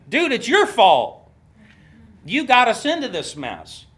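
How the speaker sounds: whiny griping at the leader; mock blame. People griping at Moses, mock accusing tone. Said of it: "get mad at the leader".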